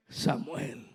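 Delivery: low, hushed call of a name. Acted. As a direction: murmur the name to a sleeper. Second, softer call to Samuel.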